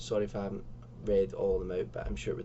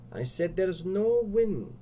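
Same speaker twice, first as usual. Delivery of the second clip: insistent repeat; 7 semitones higher, slower than usual. Acted.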